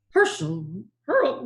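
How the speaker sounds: ordinary delivery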